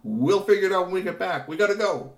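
high, loud brisk reply; loud hurried Kashi. Acted. Kashi rushing off to help, hurried. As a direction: wave off the worry.

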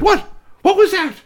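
high, loud startled cry; shrill-free startled shout from the back; startled shopkeeper. Acted. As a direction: jump out of your skin at the noise. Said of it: "Missus Maplethorne said".

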